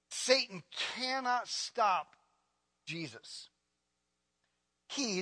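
usual speaking voice

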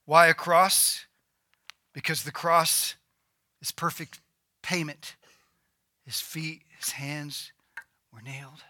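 low, quiet, solemn sermon voice; hushed preaching. Acted. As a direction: bring the sermon right down, soft and grave.

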